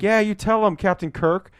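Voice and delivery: high, loud cheer, imitating. Imitating Amanda Seyfried cheering in a high, loud voice. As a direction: egg him on brightly. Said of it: "She's like".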